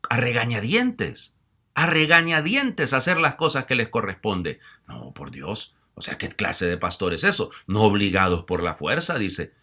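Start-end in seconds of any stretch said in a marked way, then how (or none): 0.1-4.6 slow, loud stress on regañadientes; emphatic preaching. Acted. Priest scolding grudging clergy, slow and loud. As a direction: lean hard on "regañadientes".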